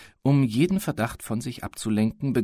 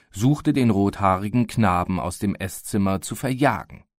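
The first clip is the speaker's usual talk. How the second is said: plain reading voice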